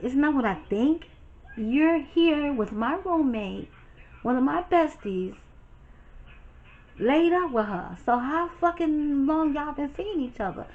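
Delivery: high, heated confrontation; angry. Retold or imitated. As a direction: confront him, incredulous and furious. Confronting cheating fiance.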